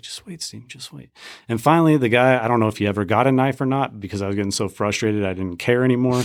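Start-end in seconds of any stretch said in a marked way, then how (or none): 0.0-1.1 very soft "just wait", barely voiced; near-whispered hush. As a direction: hush him in a crowded store. Wife hushing him in line.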